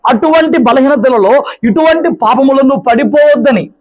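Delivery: high, impassioned pulpit preaching; fervent preaching. Acted. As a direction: preach it with rising fire. Preacher rising to full force. (ఉద్రేకంతో కూడిన ప్రసంగం)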